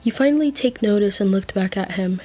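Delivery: usual speaking voice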